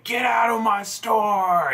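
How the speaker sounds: drawn-out, high-pitched imitation of an angry yell; mock scream. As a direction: imitate an enraged shopkeeper throwing someone out. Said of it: "he starts screaming at me".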